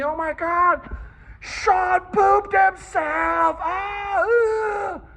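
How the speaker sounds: high, loud, drawn-out shrieking taunt; mocking kids' yell. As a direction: shriek it out pointing at him. Kids pointing and mocking.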